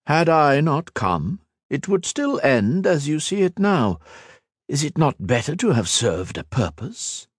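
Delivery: a lightly voiced immortal villain, scornful; villain Shinzan mocking Ethan's sacrifice, lightly voiced and scornful; cold villain. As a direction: sneer down at a mortal, unhurried. Shinzan continues his speech.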